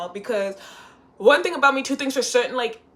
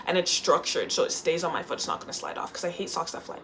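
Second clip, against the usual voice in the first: ordinary talk, nothing marked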